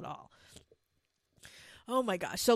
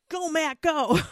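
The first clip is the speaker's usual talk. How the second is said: cheering; 7 semitones higher, 7 dB louder, slower than usual. Retold or imitated. Cheering Matt on.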